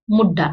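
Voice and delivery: मुड्डा with a doubled d, no nasal; मुड्डा said bare; without tippi. Shown as a demonstration. Teacher shows मुड्डा without tippi, no nasal. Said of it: "अंतर पड़ गया".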